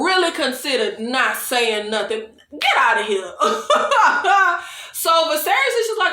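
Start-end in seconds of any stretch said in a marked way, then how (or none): 3.4-4.9 high burst of real laughter; scoffing laugh. Real, not acted. Vlogger dismissing Hightower's claim, scoffing laugh. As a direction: laugh it off in disbelief.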